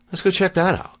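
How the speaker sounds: high, excited "let's go" pitch; eager self-quote. Retold or imitated. Thinking back to spotting the base, excited high voice. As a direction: jump up high, keen to check it out.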